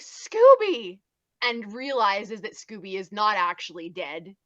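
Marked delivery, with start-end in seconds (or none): 0.0-1.0 high, loud cry of amazed joy; imitated astonished shout; overjoyed Shaggy. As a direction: call out to a friend thought lost. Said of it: "he's like".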